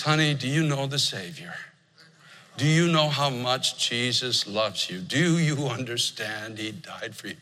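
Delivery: soft, slow and low, like a grandfather pleading; softened, slowed voice of an old man in tears; tender plea. Retold or imitated. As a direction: gently ask her as if holding her hand.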